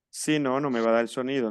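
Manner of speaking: usual speaking voice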